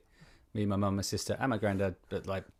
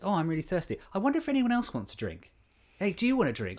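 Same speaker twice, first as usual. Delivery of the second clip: high, musing voice turning to others; considerate thinker; 8 semitones higher than usual. Shown as a demonstration. Thirsty person checking if anyone else wants one, considerate. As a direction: wonder aloud in a raised, hinting voice. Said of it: "the other ki-, type of thinker goes".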